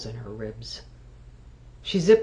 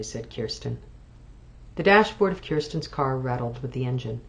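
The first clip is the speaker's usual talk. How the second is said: usual speaking voice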